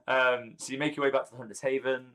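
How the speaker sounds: normal talking voice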